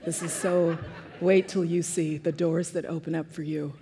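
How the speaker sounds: soft, low, sweetly proud mother; dulcet tone. Retold or imitated. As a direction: coo it softly like an adoring mom. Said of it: "she always talked in that dulcet tone".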